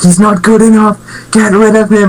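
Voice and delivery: high whiny fan voice; imitating outraged fans; mock angry fans. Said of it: "They'll be like".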